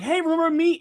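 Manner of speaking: bright high call of recognition; excited greeting. Retold or imitated. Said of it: "I'm like".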